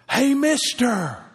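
high, slow call across the room. Retold or imitated. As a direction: call after the man leaving the restaurant. Waitress calling after him.

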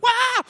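high mock shriek; sudden high-pitched "wah"; startled wife's shriek. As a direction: imitate a wife's startled yelp. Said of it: "and she's like".

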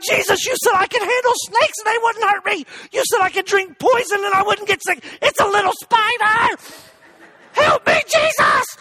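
high-pitched panicked holler; comic desperate yelling, building to a scream; yelling at Jesus. Retold or imitated. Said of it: "I'm yelling at Jesus".

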